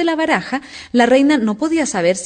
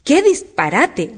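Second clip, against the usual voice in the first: higher, drawn out and resolute; decided, loud retort; 6 semitones higher, 3 dB louder, much slower than usual. A narrator's reading. Alicia defies the Queen, loud and decided. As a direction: retort firmly, stretching it out. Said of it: "muy fuerte y con tono decidido".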